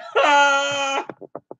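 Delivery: high shrieking burst of laughter; howling laugh. Real, not acted. Streamer cracking up at a chat joke, shrieking laugh. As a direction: lose it completely, laughing.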